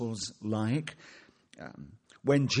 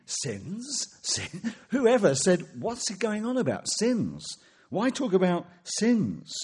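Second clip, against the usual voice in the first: indignant outrage; 8 semitones higher, slower than usual. Retold or imitated. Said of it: "the religious leaders are furious".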